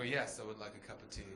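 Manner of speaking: low, soft, quick and compliant; scared guest quickly accepting tea from the stranger; meek polite reply. Retold or imitated. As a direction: say it fast and timid to avoid trouble. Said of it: "I go".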